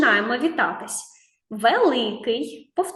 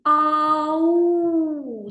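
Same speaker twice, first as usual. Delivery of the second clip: put-on girl calling out; 4 semitones higher than usual. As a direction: call out Ау as if lost in the woods. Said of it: "вона кричить". (кличе Ау, як дівчинка, що загубилася)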